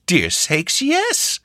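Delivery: put-on very high old woman's exclamation; delighted old country woman; high surprised granny; old hostess exclaiming. Hostess welcomes stranger.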